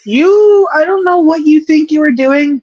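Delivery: high, loud rant at the player; exasperated yell. Real, not acted. Yelling at fumbling player.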